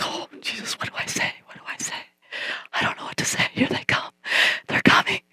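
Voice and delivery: frantic whisper to herself; panicked whisper. Retold or imitated. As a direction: hiss it under your breath, scared. Panicked whisper waiting in store.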